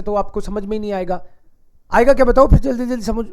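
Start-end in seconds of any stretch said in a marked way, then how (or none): 1.9-3.3 quick, louder rattled-off question; fast speech. Shown as a demonstration. Teacher challenges students to follow, speaking fast. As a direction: race through it, pushing the volume. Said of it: "अब मैं जल्दी जल्दी बोल रहा हूं".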